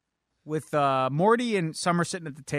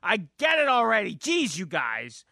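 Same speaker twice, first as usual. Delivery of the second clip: high, exasperated acted outburst; mock exasperated teen; freaking out; 8 semitones higher than usual. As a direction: start high and fed up, dropping on the last words. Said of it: "Summer just freaks out".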